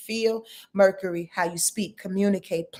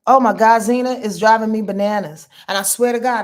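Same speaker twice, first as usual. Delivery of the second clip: much louder outburst; loud exclamation; 8 dB louder than usual. Real, not acted. Host venting to viewers about Zena, loud. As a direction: blurt it out loud.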